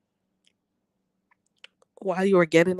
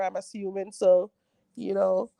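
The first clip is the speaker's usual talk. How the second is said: normal talking voice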